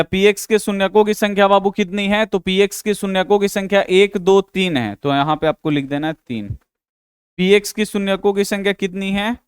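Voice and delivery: ordinary talk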